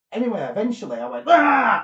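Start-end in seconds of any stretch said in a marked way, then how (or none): 1.3-1.8 loud high shout of release; breaking-free yell. Retold or imitated. Sleeper breaking his paralysis, loud high yell. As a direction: burst out with a loud high cry. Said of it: "like that, and then he'd gone".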